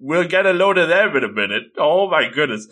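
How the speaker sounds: ordinary talk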